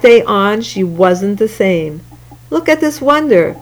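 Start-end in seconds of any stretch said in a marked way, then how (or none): 2.5-3.6 exclaiming. Acted. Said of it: "people would exclaim".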